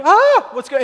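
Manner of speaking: high, loud yelp of alarm; startled cry. Acted. Beachgoer seeing a person leap off a rock, startled yelp. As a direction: cry out as if someone just leapt.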